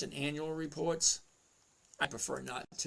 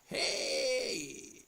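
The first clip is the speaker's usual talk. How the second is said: normal talking voice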